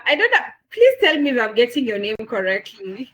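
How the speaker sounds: ordinary talk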